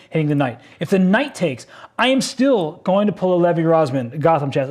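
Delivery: ordinary talk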